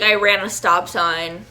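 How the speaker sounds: ordinary talk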